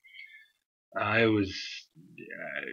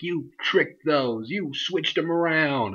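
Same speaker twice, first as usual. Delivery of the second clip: higher, slow, put-on accusing voice; mock accusing AJ; 5 semitones higher, slower than usual. Vlogger imitating AJ accusing someone of switching them, higher and slow. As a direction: drag it out like a suspicious AJ.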